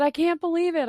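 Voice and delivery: high, loud amazed exclamation; amazed disbelief. Retold or imitated. Friend learning where she's from, high loud disbelief. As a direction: gush it like you can't believe it.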